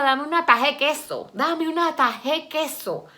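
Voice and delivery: put-on high-pitched, playful llano village accent; Venezuelan llano accent. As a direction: say it like a llano local, dropping consonants. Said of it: "donde uno habla".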